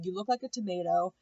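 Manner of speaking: usual speaking voice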